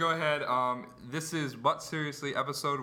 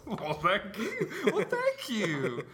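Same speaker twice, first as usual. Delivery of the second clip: high, slow, laughing thanks; hammed-up grateful reply through laughter; mock gratitude; 8 semitones higher, much slower than usual. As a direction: draw out a sarcastic thank-you, high and amused.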